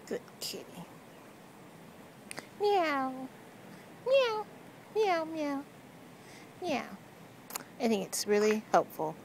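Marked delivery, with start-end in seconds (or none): 0.1-0.8 breathy whisper, much softer. Real, not acted. Self-styled cat whisperer whispering to the cat. 2.6-7.0 higher playful meows at the cat; mock meowing. Owner meowing at her cat, playful and higher. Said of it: "I am the cat whisperer".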